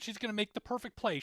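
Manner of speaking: ordinary talk, nothing marked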